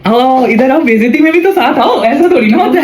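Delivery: high-pitched, teasing summons; playful calling over. Real, not acted. Calls cook over to share embarrassment. (ऊँची आवाज़ में मज़ाकिया शिकायत से बुलाना)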